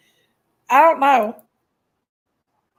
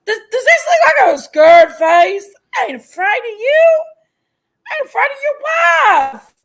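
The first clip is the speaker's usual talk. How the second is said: loud, defiant yelling through a laugh; angry, laughing shout; 5 semitones higher, 5 dB louder than usual. Real, not acted. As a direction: hurl it back, half laughing. Shouting back at a rival.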